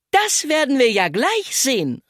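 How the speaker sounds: high, confident challenge; a girl ready to prove it; determined call. A narrator's reading. Zelda to the boys, heading for goal, determined. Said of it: "rief Zelda entschlossen".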